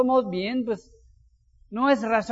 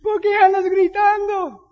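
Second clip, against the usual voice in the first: put-on high, louder child calling his dad; son's alarmed voice; 9 semitones higher, 7 dB louder than usual. Son asks his father why he is yelling. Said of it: "mi hijo me dice".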